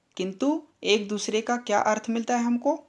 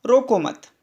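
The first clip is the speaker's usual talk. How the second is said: रोको मत as one unit, louder and falling; pause after मत; 2 semitones higher, 5 dB louder than usual. Shown as a demonstration. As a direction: hit "रोको मत" firmly with no gap inside. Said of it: "तीसरे वाक्य में देखिए".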